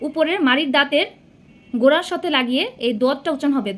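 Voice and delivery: ordinary delivery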